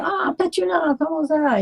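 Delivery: high, chirpy French greeting; cheery concierge. Retold or imitated. Concierge greeting the soaked singer, high and chirpy. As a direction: greet her like a delighted doorman. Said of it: "the concierge looks at me".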